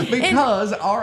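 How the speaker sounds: hammed-up Southern accent, high and loud. Host teasing guest about viral accents, mock Southern accent. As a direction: tease with a big imitated Southern accent through laughter. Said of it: "she said our accents".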